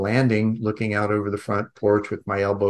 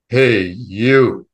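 booming male voice; 8 dB louder than usual. Retold or imitated. Unseen voice calls to a child at the window, booming. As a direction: boom it out like an all-powerful voice. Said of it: "this booming male voice".

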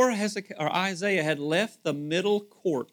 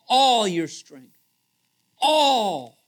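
"All" punched loud and drawn out, "All your strength" slow; emphatic all; 5 semitones higher, 9 dB louder, much slower than usual. Real, not acted. Preacher pressing the congregation on giving all, stress on "All". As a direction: hammer the word "All" each time.

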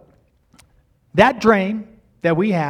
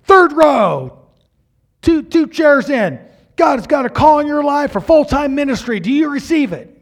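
preacher calling out; 8 semitones higher, 7 dB louder than usual. Retold or imitated. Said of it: "And he's saying".

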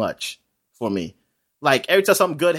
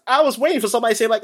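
normal talking voice

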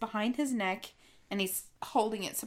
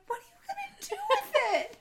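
baffled; much faster than usual. Retold or imitated. Carpenters' baffled reaction.

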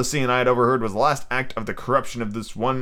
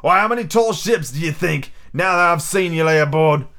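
higher-pitched indignant seaman; pirate, protesting; 6 semitones higher, 3 dB louder than usual. Acted. Pirates argue over when to strike, indignant. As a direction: argue back as a riled buccaneer. Narrator voices the sailors' argument.

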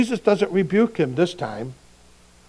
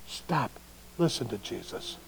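much softer, low and firm; God quietly correcting Andy; quiet divine rebuke; 6 semitones lower, 12 dB quieter than usual. Pretend. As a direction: say it gently but with weight. Repeated soft command.

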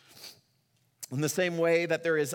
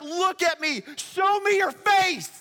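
high, loud, stretched-out desperate cry; screaming out to God; 8 dB louder than usual. Acted. Psalmist screaming out to God, begging to see his face. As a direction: scream the plea up to heaven. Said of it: "He's, like, screaming out to God".

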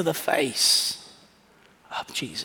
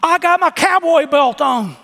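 put-on high and loud, an excited small boy; little boy voice; 10 semitones higher, 6 dB louder than usual. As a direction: brag about your cowboy belt like a kid. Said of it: "And he says".

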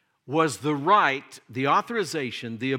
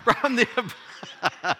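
higher and louder, breaking into laughter; laughing; 9 semitones higher, 5 dB louder than usual. Real, not acted. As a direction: restart the verse while laughing. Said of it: "are you listening, Michael?".

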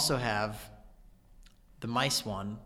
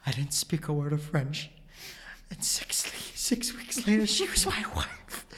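intense, breaking voice; 3 semitones higher than usual. Retold or imitated. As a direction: voice cracks high and faint on "my wife". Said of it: "this is where it gets really intense".

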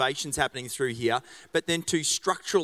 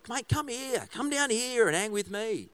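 put-on high, playful calling out to the kid; mock-calling a shy son down to the stage; calling to his son; 8 semitones higher than usual. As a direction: call the boy down from the balcony, teasingly. Pastor mimics calling his son down.

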